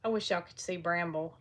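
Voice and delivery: ordinary talk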